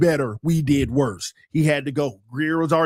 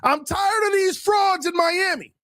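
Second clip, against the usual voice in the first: pitched way up, fired-up complaint; high-pitched rant; 11 semitones higher than usual. Real, not acted. Host ranting at the Miami frauds, high and fired up. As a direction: rail against the frauds with a raised, heated voice.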